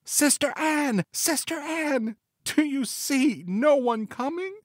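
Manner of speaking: very high, drawn-out calling of a sister's name; last desperate call. A narrator's reading. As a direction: call high and slow in the wife's voice. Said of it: "she called out for the last time".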